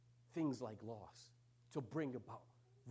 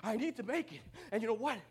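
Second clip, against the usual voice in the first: loud, high, pleading prayer building to a shout; desperate cry to the Lord; 11 semitones higher, 13 dB louder than usual. Retold or imitated. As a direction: cry out to God while out of breath. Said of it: "but I'm like".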